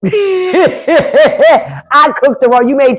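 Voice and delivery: real high-pitched laugh breaking into the words. Real, not acted. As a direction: laugh your way into the line. Laughs at own cooking mistake.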